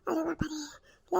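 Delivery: slow, whispered sigh of relief; relieved whisper. Acted. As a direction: breathe it out, slowly and unvoiced. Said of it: "लय शांत वाटत आहे आता". (हळू कुजबुजलेला सुटकेचा उसासा)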